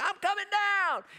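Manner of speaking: high, loud holler from the hilltop; calling out. Retold or imitated. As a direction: re-enact a gleeful shout downhill.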